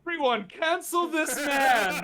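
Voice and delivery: laughing mock announcement; mock call-out. Player mock-calling out the NPC, laughing. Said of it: "player jokingly calls out".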